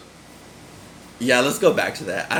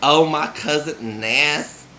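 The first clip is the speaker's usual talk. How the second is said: slow, higher Southern hometown drawl; homesick Southern accent; 4 semitones higher than usual. Acted. Voicing people who love their homesickness, Southern drawl. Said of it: "and just be like".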